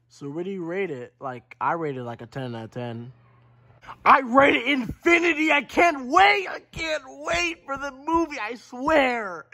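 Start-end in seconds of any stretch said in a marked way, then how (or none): 4.0-9.4 excited shout. Acted. Fan shouting about the upcoming movie, excited shout. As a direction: yell it like you can't contain yourself.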